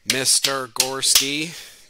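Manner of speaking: "Mister Gorski" said slow, each syllable separated; clapping syllables. Shown as a demonstration. Teacher shows students how to clap syllables, slow and spaced. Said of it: "like you clap out".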